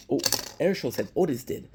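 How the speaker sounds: ordinary talk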